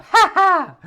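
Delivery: sudden high burst of laughter. Real, not acted. Laughs at own joke.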